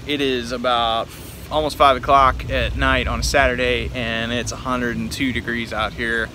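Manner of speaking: ordinary talk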